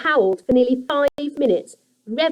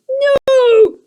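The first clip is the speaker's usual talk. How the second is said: very high, loud creature no; a trapped goblin howling; creature cry; 14 semitones higher, 8 dB louder than usual. A narrator's reading. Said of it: "growled Grunt".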